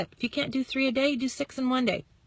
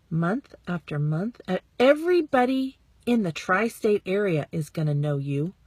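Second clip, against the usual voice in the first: ordinary delivery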